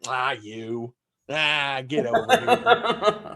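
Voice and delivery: slow, drawn-out Southern charm with a laugh; Southern accent, stretched out and endearing. Shown as a demonstration. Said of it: "but you say it with a, with a Southern accent".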